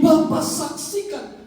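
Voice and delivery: high, drawn-out proclamation; impassioned preaching. Acted. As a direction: keep crying out to the congregation. Preaching climax continues. (seruan khotbah penuh haru)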